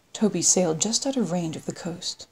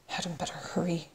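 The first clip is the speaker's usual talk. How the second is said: hushed, private thought; under the breath, much softer; inward thought; 9 dB quieter than usual. A narrator's reading. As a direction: say it softly to yourself. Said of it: "she thought".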